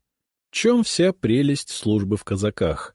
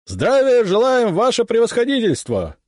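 shouting in unison; 4 dB louder than usual. Acted. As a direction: roar the reply like a whole company. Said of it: "Складно здороваются мои бойцы".

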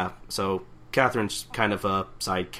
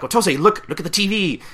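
higher, louder urgent call; excited call; 10 semitones higher, 7 dB louder than usual. Retold or imitated. Catherine calls Otose to look at the TV, higher louder call. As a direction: grab her attention at the TV.

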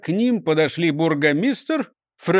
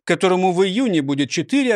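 plain reading voice

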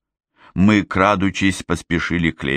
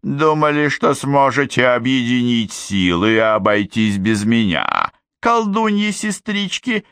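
higher, teasing reproach; lightly voiced sly fairy; 6 semitones higher than usual. Glinda teases the witch sisters, mock-hurt and high. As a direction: needle them sweetly.

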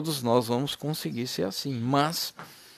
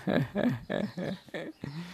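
soft real chuckle mid-sermon; a short, genuine laugh, breathy and quiet; preacher laughs remembering the brother's joy; 8 dB quieter than usual. Real, not acted. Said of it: "sabe por que que eu tô rindo?".

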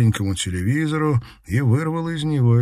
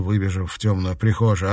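ordinary delivery

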